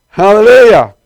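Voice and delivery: shouted hallelujah. Real, not acted. Preacher fires up the congregation with a loud shout. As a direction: throw it out to the whole room.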